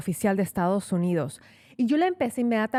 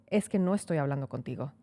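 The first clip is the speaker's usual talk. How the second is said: lower, softer curt dismissal; rude brush-off; 6 semitones lower, 4 dB quieter than usual. Retold or imitated. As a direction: brush her off coldly in a lower voice. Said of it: "básicamente no seas metiche". (desaire seco y más grave)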